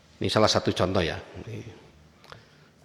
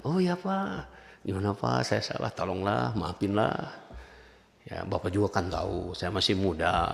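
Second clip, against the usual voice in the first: put-on soft, pleading like a scolded child; soft childlike plea to an angry elder; 6 semitones lower, 9 dB quieter than usual. Shown as a demonstration. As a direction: beg for forgiveness softly, like a young kid. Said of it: "kita merengek".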